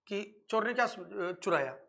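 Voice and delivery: ordinary talk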